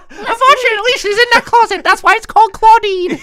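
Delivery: put-on high, bright-eyed girlish voice, loud and quick; high anime girl voice; anime schoolgirl voice. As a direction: play the sleepy student heroine in a high cute voice.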